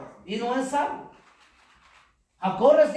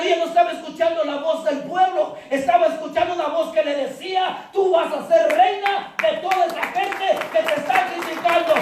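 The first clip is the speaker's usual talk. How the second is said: raised, ringing sermon voice; full-force preaching; 5 semitones higher, 3 dB louder than usual. Acted. Preacher promising the listener a crown, proclaiming. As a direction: proclaim it to the congregation.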